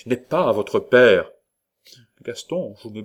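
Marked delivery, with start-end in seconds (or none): 0.1-1.3 louder and slow, each word hammered; loud, slow accusation; forceful accusation. A narrator's reading. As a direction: slam the verdict down slowly. Jealous lover's accusation.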